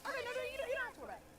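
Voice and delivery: high reassurance. Real, not acted. Singer reassuring co-host not to worry, pitched high.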